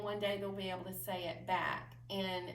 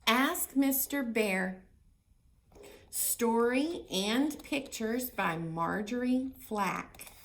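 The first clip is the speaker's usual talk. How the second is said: slow, much louder title read; reading to children; 2 semitones higher, 8 dB louder, slower than usual. Acted. Librarian reading the cover to children, slow and loud.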